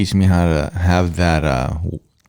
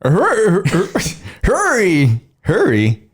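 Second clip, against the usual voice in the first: Texan accent; 9 semitones higher, slower than usual. Shown as a demonstration. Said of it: "Say, say".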